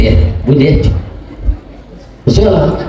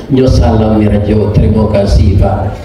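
ordinary talk

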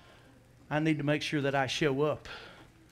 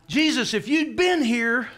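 loud, high, drawn-out complaint; slow, raised-voice reproach; acted reproach; 8 semitones higher, 7 dB louder, slower than usual. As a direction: confront him as the grieving sister. Martha's acted reproach to Jesus.

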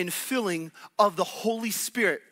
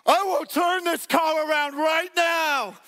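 higher, louder, slow warning; fed-up parent threat; 8 semitones higher, 4 dB louder, slower than usual. Retold or imitated. Parent on a road trip warning complaining kids, slow and raised. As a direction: lay down the law to the kids in the back. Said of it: "and you're like".

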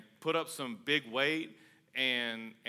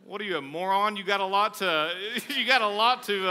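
laughing; 6 semitones higher, 7 dB louder than usual. Real, not acted. Laughing rebuke of complainer.

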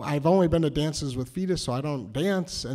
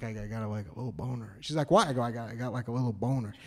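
low, soft mortified admission; embarrassed low confession; 7 semitones lower, 8 dB quieter, faster than usual. Retold or imitated. Boy at school dance confessing to his date, low and mortified. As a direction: confess it quietly, deeply embarrassed.

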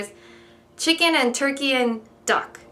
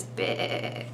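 whispered lamb bleat; 9 dB quieter than usual. Shown as a demonstration. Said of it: "That's the sound of a lamb".

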